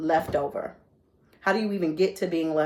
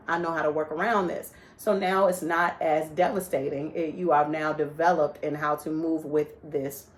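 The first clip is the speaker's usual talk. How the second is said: ordinary talk, nothing marked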